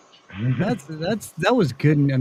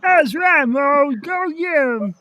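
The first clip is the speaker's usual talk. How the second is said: much higher cartoon voice; high mock cartoon character voice; silly high voice; 9 semitones higher, slower than usual. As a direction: pitch it way up like a cartoon bar patron. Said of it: "I have a plethora of voices".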